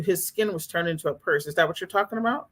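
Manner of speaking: ordinary talk, nothing marked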